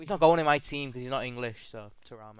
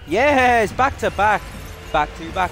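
much higher, louder cheering; triumphant shout; 7 semitones higher, 9 dB louder than usual. Real, not acted. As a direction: cheer it like a winning goal. Gets second rare card.

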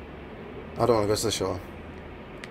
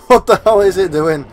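higher and much louder disbelief; loud incredulous cry; 7 semitones higher, 11 dB louder than usual. Real, not acted. Gamer crying out at the twitching creature, loud and high. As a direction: shout it half laughing.

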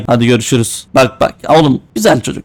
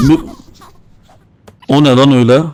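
usual speaking voice

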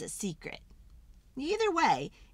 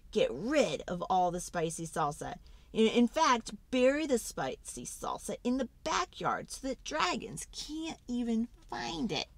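plain reading voice

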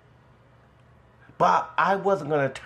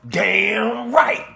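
loud, higher imitated cheer of agreement; retold scream; 6 semitones higher, 5 dB louder than usual. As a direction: belt it out, rising, as the neighbor screamed it. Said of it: "And he screamed out".

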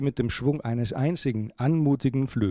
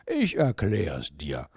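slow, soothing, lower voice; gentle; 5 semitones lower, slower than usual. A narrator's reading. Owl offers to explain to Mia, gentle. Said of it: "antwortete Vincent sanft".

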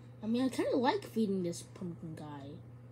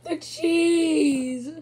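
laughing shriek; 7 semitones higher, 11 dB louder than usual. Real, not acted. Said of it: "I like how he says cheese like that".

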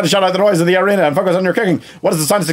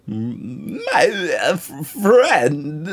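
ghostly voice; 7 semitones higher, much slower than usual. Acted. Ghost appears, crying laughing.

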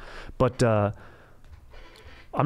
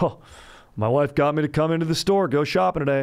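higher, louder mocking husband voice; hammed-up complaining husband, pitched up; hokey husband joke; 6 semitones higher, 4 dB louder than usual. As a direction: imitate a customer milking his tired joke. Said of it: "any guy who comes in here and has the joke".

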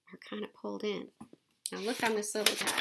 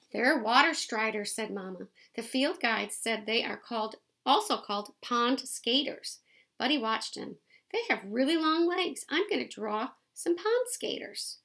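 lively picture-book read-aloud; reading to children; 8 dB louder than usual. A narrator's reading. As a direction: read it out to the kids, bright and clear.

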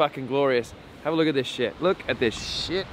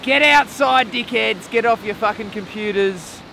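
high, loud call to get moving; rousing shout; 9 semitones higher, 8 dB louder than usual. Real, not acted. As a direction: holler it at the viewers from the open air. Rousing call to viewers outdoors.

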